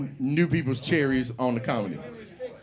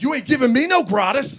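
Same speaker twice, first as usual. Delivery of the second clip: indignant retort; 8 semitones higher, 7 dB louder than usual. Retold or imitated. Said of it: "he's like".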